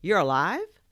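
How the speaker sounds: put-on startled kid discovering a toy talks; shocked disbelief. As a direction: blurt it in amazement.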